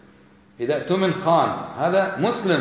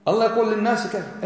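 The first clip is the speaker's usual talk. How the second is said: normal talking voice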